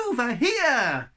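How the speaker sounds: "Over here!" called slow, high and loud; snowball call. Acted. The snowball echoes Shen at the yetis, a loud slow call. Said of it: "the snowball called".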